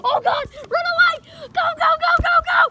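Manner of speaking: panicked high yelling; scared horror game streamer. Pretend. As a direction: scream and run for your life. Fleeing the dog beast.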